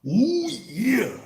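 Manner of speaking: high, loud raspy Oh yeah; Macho Man impression. Streamer doing a Macho Man impression for chat. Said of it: "I can do Macho Man".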